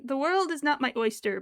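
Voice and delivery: loud mock proclamation for a cat; playfully loud, speaking as the pet; mock declaration. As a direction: proclaim it grandly, in jest. Voicing the cat's grand thought.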